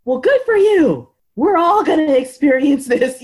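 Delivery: high, loud mock congratulation; exasperated inner comeback, loud and high; retold sarcastic retort. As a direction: throw it back sarcastically, pitch up.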